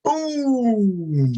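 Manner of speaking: long, high "boom", falling; imitated booming voice. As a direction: sing out a boom that fills the room. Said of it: "then your voice was like".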